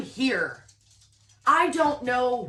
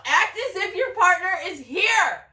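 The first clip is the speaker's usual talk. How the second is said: high, loud, excited outburst; loud and giddy, ending in a yell; freaking out; 7 semitones higher, 7 dB louder, slower than usual. Real, not acted. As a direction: cry it out, overwhelmed. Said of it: "I'm freaking out".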